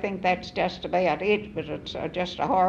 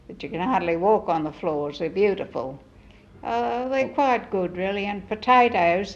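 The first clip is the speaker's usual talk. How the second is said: normal talking voice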